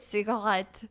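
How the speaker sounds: Cigarette said the French way, stress on the last syllable; French accent. Retold or imitated. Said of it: "That's exactly how he said it".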